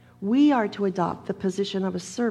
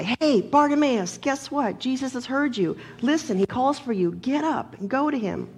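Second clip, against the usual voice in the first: higher-pitched calling out, loudest at the start; mock crowd yell; 6 semitones higher than usual. Crowd to the blind beggar, yelling that he is called. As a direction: holler over to him in a raised pitch. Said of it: "the crowd just yells at the guy".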